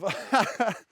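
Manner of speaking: a high, loud burst of laughter; laughing at herself for rambling about flags; breathless laugh. Real, not acted.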